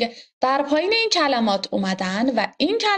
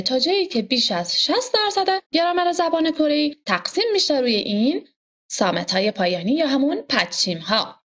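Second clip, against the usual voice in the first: ordinary talk, nothing marked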